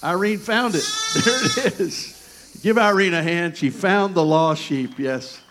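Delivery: loud and bright, celebrating the find; excited. Real, not acted. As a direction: cheer the room on as she finds it. Celebrating the found lamb.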